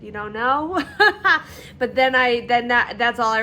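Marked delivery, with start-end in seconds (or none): 0.9-1.7 laugh. Real, not acted. Amused at her own confusion.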